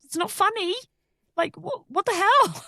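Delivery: high-pitched, incredulous mock outburst; exasperated protest. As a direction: protest in disbelief, voice shooting up. Acting out being misunderstood.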